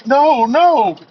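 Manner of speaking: hammed-up hero protesting; Superman refusing. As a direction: yelp out a startled refusal. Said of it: "when Superman heard that, he".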